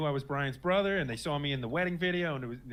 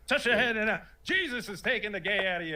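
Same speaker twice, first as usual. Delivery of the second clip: much higher, louder, hammed-up faith-healer voice; mock evangelical preacher; 9 semitones higher, 4 dB louder than usual. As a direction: lampoon a fake televangelist. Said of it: "evangelicals are like".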